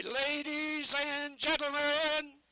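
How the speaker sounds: slow, drawn-out ringmaster call; booming barker call. Shown as a demonstration. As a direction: belt it slowly to the crowd.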